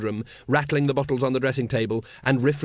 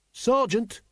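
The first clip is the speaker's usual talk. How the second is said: high-pitched urgent call for attention; 10 semitones higher than usual. A narrator's reading. Carrot warns his sergeant of danger, urgently. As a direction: urgently alert your superior, pitch raised. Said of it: "Carrot repeated urgently".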